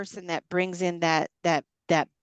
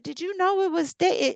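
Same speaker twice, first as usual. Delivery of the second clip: high squeaky retelling of the husband; mock husband; 11 semitones higher than usual. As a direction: squeak his question in a silly voice.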